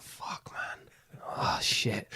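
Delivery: hushed, unvoiced curse under breath; whispering in panic at being caught out; panicked whisper. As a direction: whisper it so nobody hears. Panics when asked to introduce.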